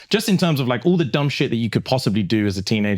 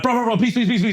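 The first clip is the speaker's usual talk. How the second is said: pleading; 10 semitones higher, faster than usual. Retold or imitated. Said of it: "And I'm like".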